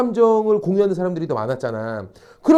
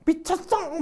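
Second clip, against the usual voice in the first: mock outburst; 10 semitones higher, 6 dB quieter, slower than usual. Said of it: "막 이러는 나를".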